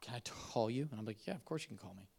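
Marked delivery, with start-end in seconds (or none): 0.0-0.9 softly whispered "can I call you"; whispered plea before surgery; hushed friend. Retold or imitated. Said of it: "And he's like". 1.3-2.1 gentle reassurance. Retold or imitated. Tender recounting of hospital call.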